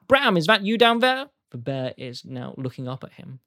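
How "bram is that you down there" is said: high, loud call down from the balcony. Acted. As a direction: shout down to the bear below. GM voices owner calling down.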